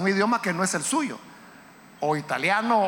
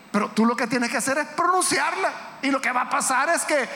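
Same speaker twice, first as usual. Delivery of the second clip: high-pitched, insistent sermon build; preacher in full cry; fervent preaching; 6 semitones higher than usual. Real, not acted. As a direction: build urgently through each repetition to a climax.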